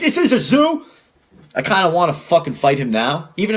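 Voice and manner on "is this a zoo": high, laughing mock of the salesman; laughing high-pitched mockery. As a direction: mimic him in a silly high voice through laughter.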